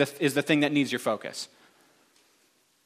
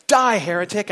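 loud, higher mock-fanatical outburst; acted zealot's cry; sudden condemnation; 5 semitones higher, 5 dB louder than usual. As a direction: condemn him abruptly at raised volume. Joke punchline: sudden turn on jumper.

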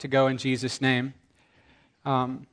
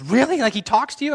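far higher and louder, rising disbelief; amazed; 12 semitones higher, 6 dB louder than usual. Retold or imitated. The girl amazed that God talks to him. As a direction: squeal it out in amazement. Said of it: "she says".

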